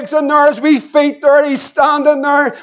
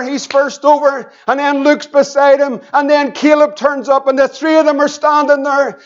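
usual speaking voice

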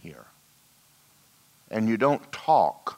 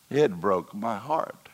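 ordinary talk

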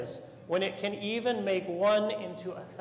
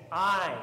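"I" said alone, louder and set apart; stress on I; 4 dB louder than usual. Shown as a demonstration. Priest voicing Jesus to the congregation, "I" stressed strongly. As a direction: punch the word "I" hard before the rest. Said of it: "very strongly".

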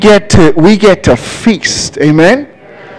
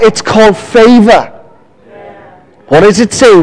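ordinary delivery

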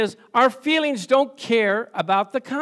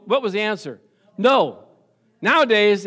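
usual speaking voice

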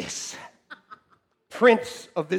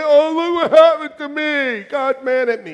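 mock self-pity; 7 semitones higher, 8 dB louder than usual. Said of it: "stop thinking that every day".

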